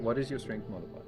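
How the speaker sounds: ordinary talk, nothing marked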